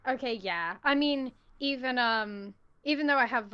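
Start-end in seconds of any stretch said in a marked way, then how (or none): none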